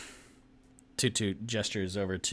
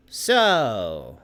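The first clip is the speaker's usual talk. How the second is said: a booming Loxodon telling him to talk; loud, firm order to talk; gruff demand; 9 dB louder than usual. Acted. Loxodon looming over a bandit, booming.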